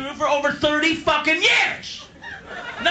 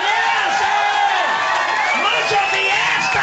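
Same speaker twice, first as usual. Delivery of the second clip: cheering fans; 7 semitones higher, 3 dB louder, much slower than usual. Retold or imitated. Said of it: "My fans were going".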